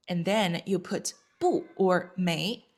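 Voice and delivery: usual speaking voice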